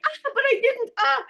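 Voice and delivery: high, playful gasps of pretend fright; mock scare. Rider who pretended they could die, mock frightened relief. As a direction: squeal in fake alarm, then relief.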